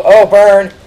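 loud, high taunt; crowing. Real, not acted. Gamer celebrates his jab at the director, loud crowing. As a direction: belt it out, gloating over the insult.

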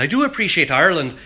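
Irish accent. Shown as a demonstration. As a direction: lean into the Irish accent on purpose. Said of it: "I even just slipped into an Irish accent".

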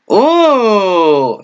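sudden high, loud "uh"; surprised gasp. Real, not acted. Vlogger reacting to a shocking scene, startled. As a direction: jolt at what just appeared.

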